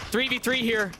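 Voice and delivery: live play-by-play commentary; esports caster. Acted. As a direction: call the round like a caster.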